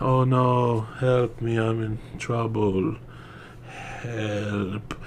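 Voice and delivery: soft and unhurried, fading to breath; barely bothered, trailing off; mock calm cry for help. Mocking the actress in the shark's mouth, fading calm. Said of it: "So freaking calm".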